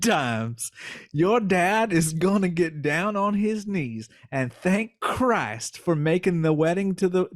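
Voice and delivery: sly silky Southern fox; Southern fox twang. Acted. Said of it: "twang for the voice of the fox".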